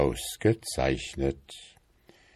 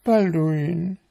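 high-pitched, fussy wife voice, louder; nagging wife beetle; 12 semitones higher, 3 dB louder than usual. A narrator's reading. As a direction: pester him in a high, insistent voice. Wife calls husband.

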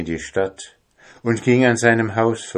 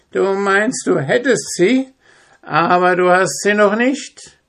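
put-on high, spiteful old witch voice; venomous witch; 13 semitones higher, 5 dB louder than usual. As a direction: cackle it out like a gloating witch. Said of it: "lachte giftig".

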